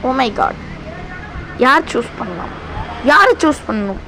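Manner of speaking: ordinary talk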